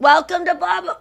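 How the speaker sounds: high-pitched fake-cheery greeting; put-on customer service pep; mock enthusiasm. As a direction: chirp it like a forced staff welcome. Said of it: "The enthusiasm of".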